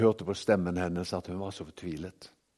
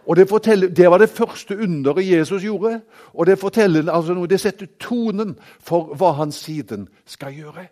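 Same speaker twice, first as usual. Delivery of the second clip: raised, louder pulpit voice; ringing sermon delivery; full-force preaching; 8 semitones higher, 13 dB louder than usual. Acted. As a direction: proclaim it to the whole congregation. Preaching opening on Jesus' miracle.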